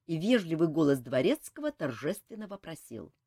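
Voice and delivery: ordinary delivery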